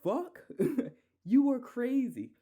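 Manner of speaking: high, laughing mock outrage; outraged disbelief. Imagining his boss firing him, laughing disbelief. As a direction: squeal it in stunned disbelief. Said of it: "I'll be like".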